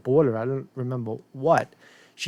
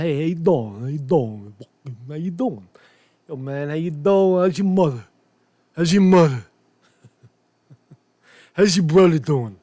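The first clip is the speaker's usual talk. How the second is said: neighborhood street greeting; 3 dB louder than usual. Retold or imitated. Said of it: "That's how they say it in my neighborhood".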